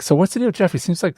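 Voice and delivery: high-pitched, doubtful probing; retold skeptical question, not mean. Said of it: "I was kinda, like, skeptical".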